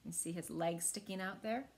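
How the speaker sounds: plain reading voice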